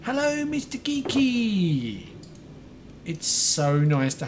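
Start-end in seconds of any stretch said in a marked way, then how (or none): 0.0-2.1 high, long-drawn gleeful greeting; excitable. Real, not acted. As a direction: sing out a slow, high hello. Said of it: "a little bit loud and excitable".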